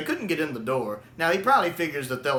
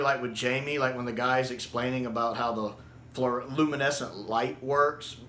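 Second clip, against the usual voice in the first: usual speaking voice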